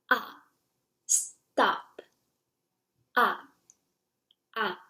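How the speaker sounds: brief falling syllables, like commanding "stop"; fourth tone. Shown as a demonstration. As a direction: drop each "ah" and "stop" sharply. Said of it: "It's from the highest pitch to the lowest pitch".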